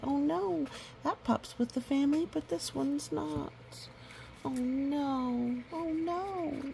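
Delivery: high, mock-worried "oh no". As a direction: sound alarmed for the little pup.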